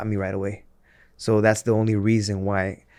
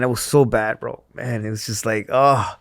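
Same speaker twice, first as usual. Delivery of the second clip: ordinary delivery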